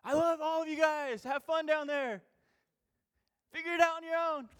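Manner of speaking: mock distant God shouting down; God shouting from above. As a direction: call out carelessly from far above. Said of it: "did he shout it out loud".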